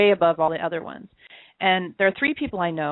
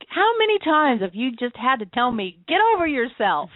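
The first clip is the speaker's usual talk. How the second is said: higher-pitched mock exasperation, hitting the opening; exasperated reproach; 4 semitones higher than usual. Friend throwing her own advice back at her, mock exasperated. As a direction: imitate a friend's exasperated chiding.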